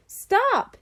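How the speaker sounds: high, sharp order; a boy ordering his digging dog to stop; sharp command. Acted. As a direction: stop the dog with one word.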